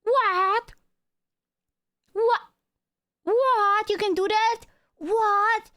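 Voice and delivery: high-pitched, incredulous squeal of a question; mock astonishment. Mock astonished chat reaction.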